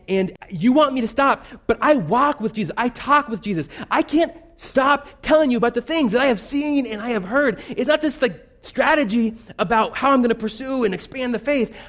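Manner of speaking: high, pleading preacher's cry; preaching at full force. Acted. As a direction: pour it out, unable to hold back. Passionate climax of sermon.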